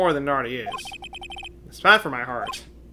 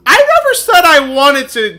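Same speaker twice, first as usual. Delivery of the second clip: louder, higher objection; loud protest; 8 semitones higher, 12 dB louder than usual. Real, not acted. Streamer complaining to chat, a loud protest. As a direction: push back loudly.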